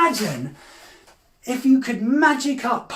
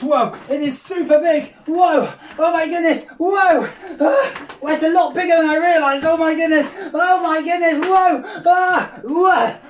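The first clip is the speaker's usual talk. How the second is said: high, loud, astonished whoas; mock amazement; 5 semitones higher, 4 dB louder than usual. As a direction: gasp and exclaim at something enormous. Pulls out a giant wand.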